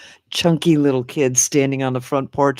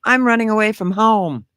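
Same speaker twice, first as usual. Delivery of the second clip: put-on high, louder childish declaration; imitated defiant little boy; 10 semitones higher, 5 dB louder than usual. Young son announces he is leaving, mock defiant. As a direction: mimic a kid announcing his big threat. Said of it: "which one of my boys was it that said".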